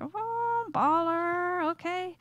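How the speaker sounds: high, drawn-out cooing; playfully impressed. Acted. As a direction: stretch it out, mock-impressed by a big spender. Imagined date impressed by fancy restaurant.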